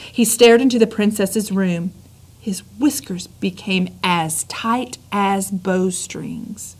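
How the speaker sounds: plain reading voice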